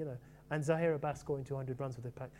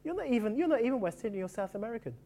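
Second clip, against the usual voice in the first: mock jibe; 8 semitones higher, 3 dB louder than usual. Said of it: "he would say".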